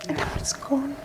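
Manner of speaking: breathless whisper near tears; tearful whisper. Real, not acted. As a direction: whisper it, overcome. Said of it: "She almost made me cry here".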